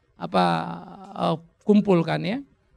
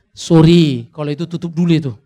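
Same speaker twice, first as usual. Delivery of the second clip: much louder, blurting a stop; loud mock cut-off; 11 dB louder than usual. Pastor stopping wives asking for grocery money, loud mock cut-off. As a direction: shout down the idea jokingly. Pastor cuts off a joke request.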